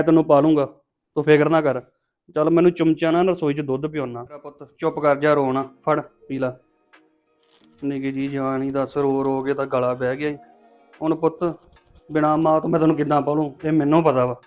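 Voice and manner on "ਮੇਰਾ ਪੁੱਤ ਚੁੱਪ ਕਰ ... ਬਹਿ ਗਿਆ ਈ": soft, low comforting; tender hushing. Acted. Man feeding the baby hoarse from crying, tender. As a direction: hush the baby softly.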